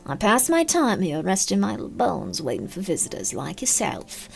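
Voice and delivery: a weary centuries-suffering British crone, murmuring; British accent old woman. Acted. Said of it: "you do a British accent perfectly".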